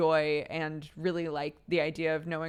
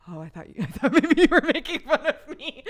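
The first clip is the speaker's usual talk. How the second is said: louder and higher, laughing through the words; 11 semitones higher, 9 dB louder than usual. Retold or imitated. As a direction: giggle it out, embarrassed. Retelling reply to Sam, laughing.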